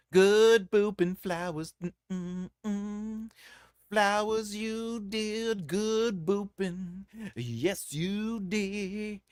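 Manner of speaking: put-on high cooing baby voice; baby talk. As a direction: coo at them like a toddler. Praising viewers in a baby voice.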